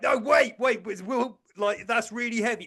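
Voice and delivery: hammed-up anxious bodybuilders; mock worried lads. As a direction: send up the panicking big lads. Mocking the big lads.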